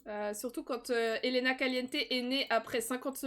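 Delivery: ordinary talk, nothing marked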